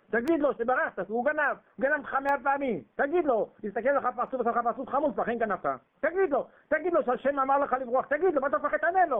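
shouted exhortation. Acted. Preacher exhorting Jacob to answer Laban, shouting. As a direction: shout at him to answer back.

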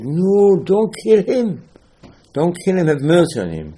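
high, loud cry of don't kill him; a high urgent plea to spare it; pleading rabbi. Acted. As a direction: plead urgently for the mouse's life.

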